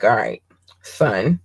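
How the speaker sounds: lightly voiced dad, pitched low; a low, understated fatherly voice; dad impersonation. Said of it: "my best dad impersonation".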